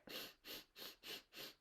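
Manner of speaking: rapid short puffs of breath; quick breathing. Shown as a demonstration. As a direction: pant in quick, even breaths. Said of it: "whether I breathe quick".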